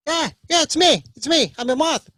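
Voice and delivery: high, loud excited father voice; moth dad. Acted. Father moth greets his son, high and loud. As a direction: chirp it out like a proud moth dad.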